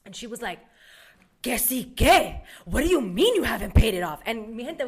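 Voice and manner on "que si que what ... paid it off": indignant mother's tone; mom's indignant tone. Retold or imitated. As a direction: sound shocked and accusing. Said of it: "it's the tone".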